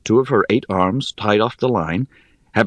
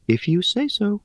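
high, airy, teasing spider; airy little voice; 12 semitones higher, 3 dB quieter than usual. Acted. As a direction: toss it off lightly, amused.

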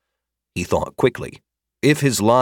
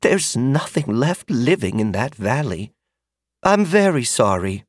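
gentle; 4 semitones higher than usual. Acted. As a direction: comfort the boy gently. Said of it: "adding gently".

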